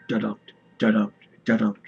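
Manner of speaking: loud thumping "Da-dum", each beat punched; loud heartbeat. A narrator's reading. Narrator pounding out the heartbeat for viewers, loudly.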